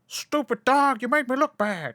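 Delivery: high, put-on cartoon scolding; hammed-up cartoon grump, pitched well up; cartoon catchphrase impression. Cartoon catchphrase impression after "the obvious.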